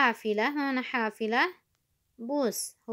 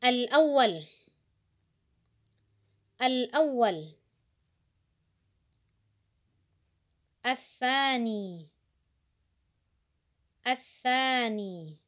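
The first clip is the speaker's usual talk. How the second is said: slow, drawn-out model pronunciation; teacher modelling each word for repetition; listen-and-repeat drill; 2 semitones higher than usual. Shown as a demonstration. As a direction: stretch the long vowel and let it fall. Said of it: "dengarkan, a'id, ulangi".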